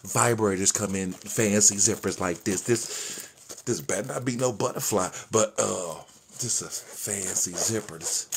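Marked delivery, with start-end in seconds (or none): none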